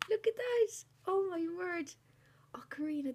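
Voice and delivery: high, loud, thrilled; delighted squeal. Real, not acted. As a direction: squeal with joy at a gift. Thrilled by decorations.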